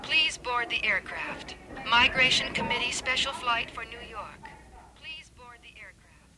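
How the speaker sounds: airport announcement over a loudspeaker; distant PA speaker voice; over the intercom. Acted. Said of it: "a voice came over the speaker".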